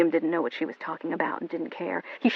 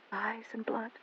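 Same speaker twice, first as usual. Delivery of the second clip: much softer, barely voiced; fading whisper; 4 semitones higher, 14 dB quieter, slower than usual. A narrator's reading. Narrator echoing the refrain as a whisper. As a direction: let it die away.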